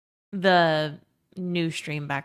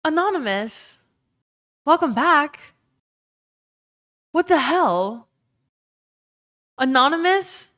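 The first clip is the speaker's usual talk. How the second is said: excited shouting; 8 semitones higher, 4 dB louder than usual. Real, not acted. Surprise anonymous big gift.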